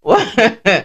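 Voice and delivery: high giggling burst; giggle. Real, not acted. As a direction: break into giggles. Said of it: "can't stop laughing now".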